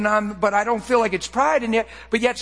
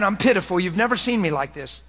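usual speaking voice

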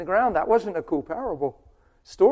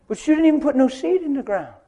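scolding wife; 9 semitones higher, 6 dB louder than usual. Acted.

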